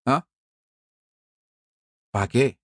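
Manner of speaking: usual speaking voice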